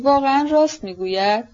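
understated impatience, pitch lifted; impatient question. A narrator's reading. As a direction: ask with a touch of exasperation.

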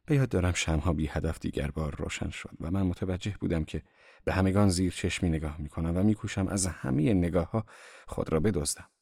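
usual speaking voice

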